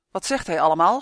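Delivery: loud, suspicious demand to know; suspicious growl. A narrator's reading. Goblin king distrusts the elf's chatter, loud suspicious growl. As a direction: growl the question out loudly. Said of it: "gromde de koning achterdochtig".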